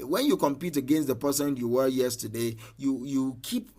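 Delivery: usual speaking voice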